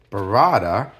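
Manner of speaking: ordinary talk, nothing marked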